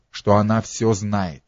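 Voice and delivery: plain reading voice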